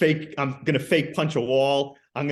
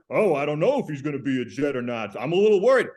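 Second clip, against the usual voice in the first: whiny send-up of a fretting pal; mock worried; 4 dB louder than usual. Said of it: "you sometimes text me and be like".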